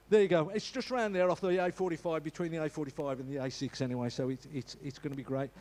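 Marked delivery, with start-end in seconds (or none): none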